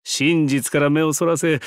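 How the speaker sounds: ordinary delivery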